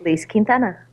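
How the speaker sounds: "Lise Quintana" as its owner says it; correct name pronunciation. Shown as a demonstration. Guest enlightening hosts on her name, model pronunciation of "Lise Quintana". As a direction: show exactly how "Lise Quintana" is pronounced. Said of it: "how your name is actually really s-, pronounced".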